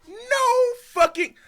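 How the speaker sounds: high, loud, stretched-out yell; outraged shout. Real, not acted. Streamer shouts back at the video in outrage. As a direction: blow up in disbelief.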